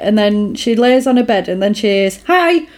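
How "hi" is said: high-pitched, chirpy mock hello; sudden greeting. Acts Jennifer's sudden startling greeting.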